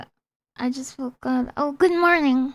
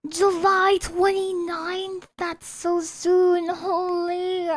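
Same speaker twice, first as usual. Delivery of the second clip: awed; 4 semitones higher, much slower than usual. Real, not acted. Awed by release date.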